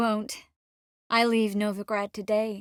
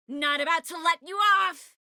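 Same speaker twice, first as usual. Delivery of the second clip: raised, higher-pitched shout; playful shout; 9 semitones higher than usual. Acted. Refusing to release someone, shouted. Calling out in a game scene.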